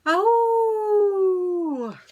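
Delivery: high, loud howl at the moon; a loud coyote howl up at the moon. Acted. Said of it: "and howled".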